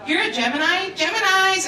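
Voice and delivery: rising, gasped mock shock; shocked friend. Friend replying to the birthday invite, in mock shock. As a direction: gasp it like astrology horror. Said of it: "the first person responded and went".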